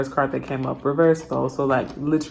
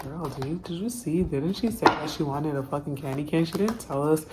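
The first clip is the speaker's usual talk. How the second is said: softer, hushed mock gossip; gossipy whisper; 7 dB quieter than usual. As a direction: half-whisper it like office gossip. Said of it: "they start whispering".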